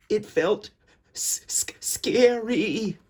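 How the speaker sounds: stammering the s before scary; scared stutter. A narrator's reading. Narrator to children, mock scared stammer.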